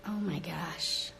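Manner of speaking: exasperated sigh. Real, not acted. Exasperated sigh after a pause.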